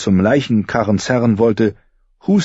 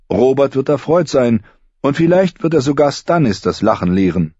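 higher, upbeat letter reading; cheerful lord's letter; 7 semitones higher than usual. A narrator's reading. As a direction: read it like a pleased lord. Lord's letter read aloud.

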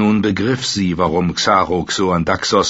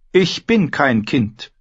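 slow, high protest; a young queen insisting; indignant queen; 8 semitones higher, slower than usual. Acted. Dany rejects the warlock's word, slow and high.

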